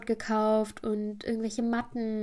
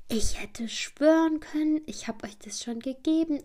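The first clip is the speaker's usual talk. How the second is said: higher-pitched, slow start in stunned disbelief; retold astonished insistence; imitated bewilderment; 5 semitones higher than usual. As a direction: play someone flabbergasted, not at all angry. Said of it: "sie war richtig entgeistert".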